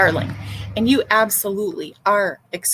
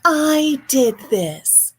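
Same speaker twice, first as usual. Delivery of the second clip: slow, higher smug boast; mocking self-promoter; 5 semitones higher, much slower than usual. Mocking vigil organizers posting for credit, slow smug voice. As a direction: mimic a glory-hunter bragging. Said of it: "on social media and say".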